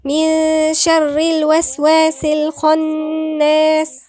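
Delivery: slow, loud Quranic recitation; recitation with heavy kha. Shown as a demonstration. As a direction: hum the ن of من, make the خ in الخناس full and heavy. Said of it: "خا مفخمة".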